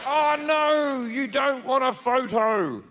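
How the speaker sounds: high, drawn-out fake dismay; mock disappointment. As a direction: act let down by the abuse. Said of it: "I'll be like".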